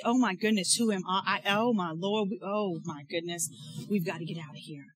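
a soft, hushed mock panic; mock fearful fretting. Preacher voicing the doubter David never was, hushed mock fear. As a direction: play the scared doubter, dropping away quietly. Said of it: "He was not scared".

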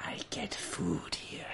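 slow, soft, breathy beast voice; salamander creature. Acted. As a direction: speak low and wary like a caged fire beast. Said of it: "he prowls around his volcano and he says".

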